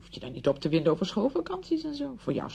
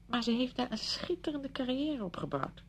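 impressed; 6 semitones higher, 4 dB quieter than usual. Acted. Talking up Stella's success.

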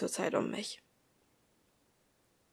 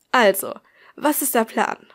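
eager question; 7 dB louder than usual. A narrator's reading. Eager question after agreeing.

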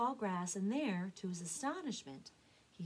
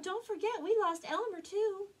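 very high, louder mama duck; crying out; 12 semitones higher, 7 dB louder than usual. Acted. Mama duck pleading for her lost son, crying out high. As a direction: wail it out in a high voice. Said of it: "Mama cried out".

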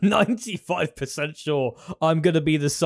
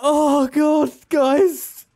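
high-pitched, laughed-out wail; helpless, giddy amazement; overcome disbelief; 11 semitones higher, 4 dB louder, much slower than usual. Real, not acted. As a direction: squeal it, worn out from laughing. Overcome after unexpected win.

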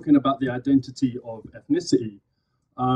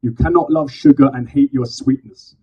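spoken word poem; 7 dB louder than usual. Acted. Poet performing to a live crowd, loud slam declamation. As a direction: declaim each phrase to the crowd.

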